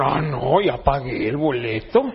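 low, lightly voiced husband; a hint of a grumbling husband; husband's reply. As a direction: drop low for the husband's refusal. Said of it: "luego te dice la esposa".

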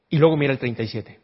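usual speaking voice

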